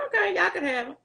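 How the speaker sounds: very high, offhand imitation of her young self; like it's nothing. Teen handing baby to aunt and uncle, like it's nothing. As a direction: give it away lightly, pitched right up. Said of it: "I'm talking like it's nothing".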